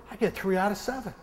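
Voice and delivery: ordinary talk, nothing marked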